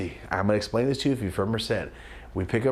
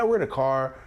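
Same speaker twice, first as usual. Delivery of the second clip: normal talking voice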